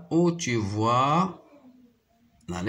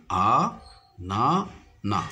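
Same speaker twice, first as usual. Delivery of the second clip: "A, na, na" as three separate beats; syllable by syllable. Shown as a demonstration. Teacher listing the three syllables of anana, syllable by syllable. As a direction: count out "A, na, na" with gaps. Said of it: "se compose de trois syllabes".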